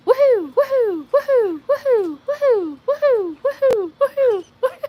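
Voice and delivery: high, loud repeated woohoo cheers. Pretend. Streamer whooping woohoos for a viewer's level up. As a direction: whoop it up for the chat. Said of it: "mega woohoo".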